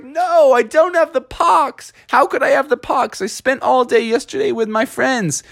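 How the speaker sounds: high, pleading denial; upset protest. Acted. Frog insists to his dad he is well, high upset protest.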